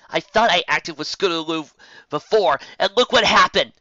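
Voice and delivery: loud, angry outburst; snapped. A narrator's reading. Rainbow Dash snapping angrily at Twilight, rising to a shout. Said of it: "she snapped at Twilight".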